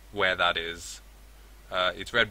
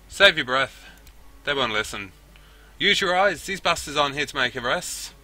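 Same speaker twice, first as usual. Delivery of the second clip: loud, higher, forceful retort; urgent shouted warning; 6 semitones higher, 7 dB louder than usual. Acted. Warns Duncan the police won't listen, urgent shout. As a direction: cut across him over the gunfire.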